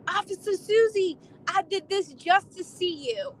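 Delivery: imitating the lovestruck man. Arrested man confessing to the officer, high doting mock voice. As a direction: play the smitten arrested guy declaring himself. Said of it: "and he said".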